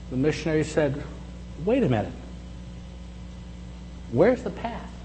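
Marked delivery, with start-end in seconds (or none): none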